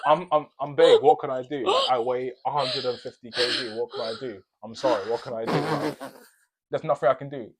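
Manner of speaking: loud, laughing mock plea of the upstairs neighbour. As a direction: ham up her helpless excuse, loud and amused. Mocking upstairs neighbour's excuse.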